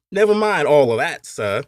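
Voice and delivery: loud, high Cockney cut-in; Cockney accent. Acted. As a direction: brush him off in a Cockney accent. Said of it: "had said a man with a Cockney accent".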